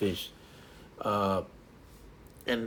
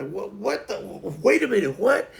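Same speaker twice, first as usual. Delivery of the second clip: mock shock; 8 semitones higher, 3 dB louder than usual.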